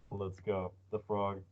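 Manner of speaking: ordinary delivery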